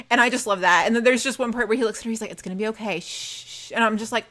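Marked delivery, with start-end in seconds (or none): 2.3-3.7 soft reassurance fading into hushing; calm voice. Retold or imitated. Jay looking at shot Maddie, calm and hushed. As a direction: soothe gently, then whispered shushes. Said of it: "he's just full on calm voice".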